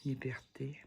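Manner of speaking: soft unvoiced whisper; whispered card name. Real, not acted. Reading the tarot card aloud, she whispers. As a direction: breathe the word out.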